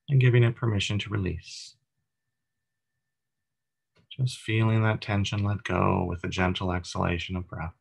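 even, unhurried meditation voice with long pauses; calm, steady body-scan narration; guided meditation. Acted. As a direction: guide listeners gently through breath and body, leaving space between phrases.

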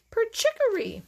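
very high chirped trill; bird song. A narrator's reading. A bird answers the whistle, high piping. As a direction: chirp it back like a songbird. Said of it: "a bird sang".

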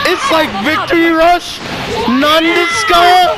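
high, loud amazed shouting; drawn-out, high-pitched gamer hype; hyped outburst. Real, not acted. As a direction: react like you just saw an unbelievable move. Hyped reaction to game move.

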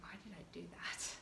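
soft unvoiced self-question; whisper. Real, not acted. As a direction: whisper it to yourself.

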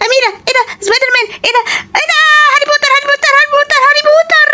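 put-on high, excited child voice; high-pitched kid, thrilled. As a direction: squeal like a kid spotting toys. Child character excited by costumes.

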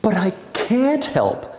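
sputtering. A narrator's reading. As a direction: sputter it out helplessly. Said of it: "sputtered Ida".

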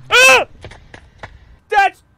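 high startled shriek; scared horror game streamer. Real, not acted. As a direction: cry out at a scare. Jump scare reaction.

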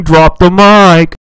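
very loud, slow, distorted brag; loud boast. Acted. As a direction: shout it like a mic drop. Bragging about edit.

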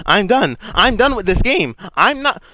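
high, loud frustrated yell; raging shriek at the game; screaming rage. Real, not acted. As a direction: scream that you quit. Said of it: "me screaming".

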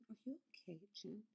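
very quiet put-on worry; hushed mock concern. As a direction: imitate a coworker's oversweet check-in. Retells coworker's feigned concern to her.

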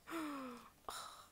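long awed gasp. Real, not acted. Fan overwhelmed by a cute card, long gasp. Sees favourite member card.

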